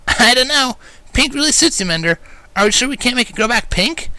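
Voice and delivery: high, brash tomboy voice, teasing; teasing Rainbow. A narrator's reading. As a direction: needle him playfully about the pink.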